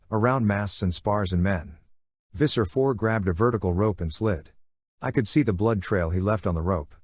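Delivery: ordinary delivery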